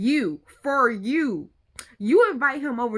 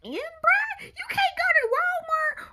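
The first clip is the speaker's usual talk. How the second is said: very high questioning voice; incredulous squeal; slower than usual. Real, not acted. Questioning the broke date's excuse, incredulous squeal. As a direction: shoot way up in pitch, baffled.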